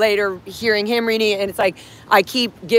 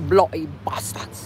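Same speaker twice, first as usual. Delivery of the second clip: low muttered put-on English accent; mock English accent; 4 semitones lower, slower than usual. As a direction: grumble it in a fake English accent. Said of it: "I have an English accent doing that".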